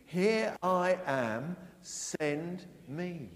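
slow, loud, leading the congregation; loud call. Shown as a demonstration. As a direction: say it out slowly for all to repeat. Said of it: "Can we say that after three?".

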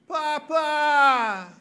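put-on high, loud little child's cry; child calling papa. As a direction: call out like a tired toddler. Toddler turns round, calls father.

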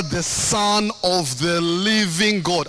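"living" drawn out slowly for emphasis; stress on living. Real, not acted. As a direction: lean slowly on the word "living". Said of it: "I want to stress the word living".

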